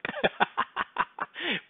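high burst of giggling; amused laugh. Real, not acted. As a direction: crack up at a viewer's joke. Laughs at chat comment.

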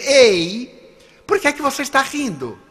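high-pitched, quick indignant demand; retold angry challenge. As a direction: challenge a stranger from afar, crossly. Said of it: "Com raiva". (cobrança irritada, em tom agudo)

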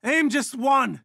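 high, anguished shout of defeat; dismayed cry. Acted. Commander to the team as the enemy wins, dismayed cry. Setback in battle voice lines.

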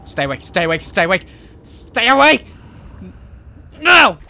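pleading, then yelling at full volume; desperate building shout. Real, not acted. Player fighting off sleep in the game, panicked rising shout.

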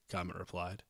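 usual speaking voice

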